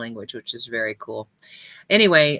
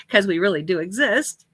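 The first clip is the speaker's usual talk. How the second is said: real laugh leading into higher-pitched words; amused laughing; 5 semitones higher, 4 dB louder than usual. Real, not acted. Pastor assuring viewers the church really exists, laughing.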